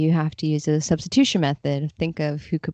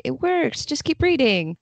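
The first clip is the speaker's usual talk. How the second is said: breezy hand-waving; 7 semitones higher than usual. Retold or imitated. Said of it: "hand waving and say".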